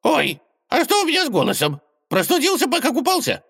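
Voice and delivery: high squeaky gopher, laughing; squeaky rodent voice with a nervous laugh. Acted. Gopher alarmed at his voice.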